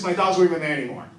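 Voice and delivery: normal talking voice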